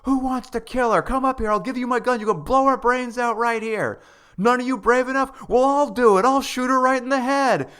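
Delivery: retelling a cop daring protesters, hammed-up and rising; sarcastic cop. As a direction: ham up the cop daring the crowd to shoot. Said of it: "He's being sarcastic".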